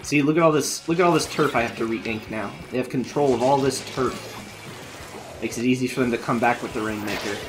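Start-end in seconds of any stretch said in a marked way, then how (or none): none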